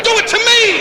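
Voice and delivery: high falsetto squeal; wife's squeal. Retold or imitated. Comic voices Evel's wife crying out in a high falsetto. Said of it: "She goes".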